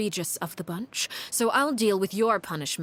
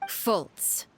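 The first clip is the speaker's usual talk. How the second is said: ordinary delivery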